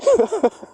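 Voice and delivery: a high, mocking cackle. Real, not acted. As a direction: burst out in a high laugh. Laughing at the fool.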